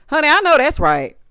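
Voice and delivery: usual speaking voice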